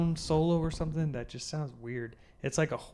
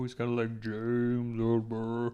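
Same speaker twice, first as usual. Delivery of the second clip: low singer imitation; 3 semitones lower, 3 dB quieter, slower than usual. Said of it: "sounds like someone low".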